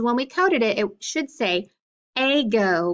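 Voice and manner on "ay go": "ago" sounded out with a long A, "AY-go"; long A mispronunciation. Shown as a demonstration. Teacher showing the class the coded reading, long-A "ago". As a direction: say "ago" as AY-go, first syllable like the letter A. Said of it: "but we don't say that".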